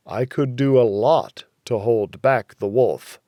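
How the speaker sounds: usual speaking voice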